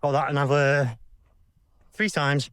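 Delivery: panting after a hard mile; out of breath. Real, not acted. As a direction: catch your breath between words. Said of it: "So get me breath".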